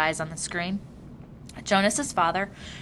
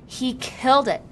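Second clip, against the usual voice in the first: louder, higher shocked outburst; stunned; 5 semitones higher, 6 dB louder than usual. A narrator's reading. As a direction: realize the horror aloud. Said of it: "said to himself, stunned".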